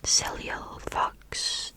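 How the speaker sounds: plain reading voice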